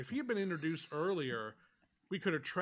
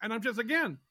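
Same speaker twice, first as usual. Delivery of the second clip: normal talking voice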